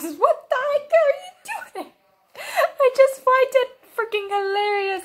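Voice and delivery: high, laughing cry of amazement; laughing disbelief. Real, not acted. Watching the scene, high laughing exclamation. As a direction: squeal through the laughter.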